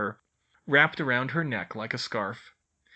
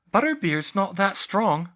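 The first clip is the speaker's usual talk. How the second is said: Hermione voice; 8 semitones higher, 6 dB louder than usual. A narrator's reading.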